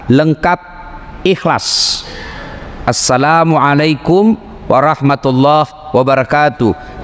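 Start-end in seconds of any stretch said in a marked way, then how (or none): 2.9-7.0 slow, careful full salam, every word given its weight; full proper salam. Shown as a demonstration. Preacher showing the right salam, slow and deliberate. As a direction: model the complete greeting slowly for the congregation. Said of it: "Jangan lagi ganti-ganti".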